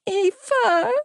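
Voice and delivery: put-on high, small child voice on the phone; young child's voice. A narrator's reading. Little boy phoning his brother's girlfriend, in a young voice. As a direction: call for her as an upset little boy. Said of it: "A young voice came down the line, followed by the sound of sniffling".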